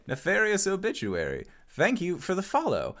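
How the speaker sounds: normal talking voice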